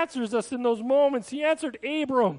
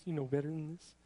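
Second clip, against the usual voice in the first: the parental line repeated much softer and lower; quiet quoted phrase; 8 semitones lower, 11 dB quieter, faster than usual. Retold or imitated. Preacher quoting parents to the congregation, softly and low. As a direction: murmur it as if to yourself. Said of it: "a common statement from parents".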